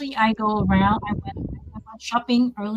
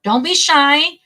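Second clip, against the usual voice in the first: louder and higher, a playful nudge; cheerful encouragement; 4 semitones higher, 6 dB louder than usual. Real, not acted. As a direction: coax the audience to chime in. Host urging audience to join.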